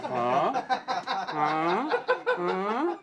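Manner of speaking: comic bewildered creature noises; puzzled dragon. Acted. The dragon hears a strange sound, puzzled grunts.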